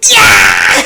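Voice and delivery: explosive, low-pitched yelled "yeah"; loud cheer. Real, not acted. Player cheering mid-game, loud shout. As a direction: let out one huge shout.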